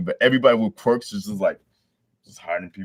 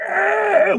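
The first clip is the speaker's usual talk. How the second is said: much higher, louder shriek; mock crowd scream; 8 dB louder than usual. Panicked crowd in the rain, shrieking.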